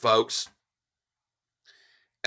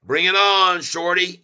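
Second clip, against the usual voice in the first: loud, higher jeering challenge; taunting call-out; 7 semitones higher, 7 dB louder than usual. Real, not acted. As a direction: throw down the gauntlet at the shorts. Taunting short sellers at chart.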